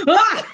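sharp high yelp, then a burst of laughing; startled shriek into laughter. Shown as a demonstration. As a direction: jump with fright, then laugh it off. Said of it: "the surprise laugh".

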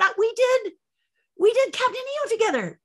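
high, insistent retort; indignant protest. Retold or imitated. Answering friends who doubt she knows the star, high indignant retort.

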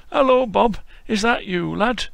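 put-on old Yorkshire grandad greeting a visitor; frail old Yorkshireman. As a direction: play a creaky old Yorkshire lad-caller. Voicing old Yorkshire Bob.

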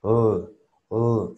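"uh" as a dark, rounded English L; isolated dark L, held; fat English L. Shown as a demonstration. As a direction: make the L sound fat, like "ul". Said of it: "la L inglesa tiene que sonar gordita".

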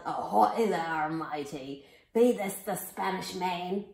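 hammed-up storybook pirate shout; yelling pirate. A narrator's reading. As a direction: boom it out like a pirate captain. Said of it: "and yelled".

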